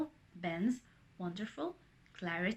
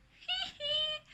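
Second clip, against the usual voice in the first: acted high-pitched hee-hee; high playful giggle. A narrator's reading. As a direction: giggle like teasing waves.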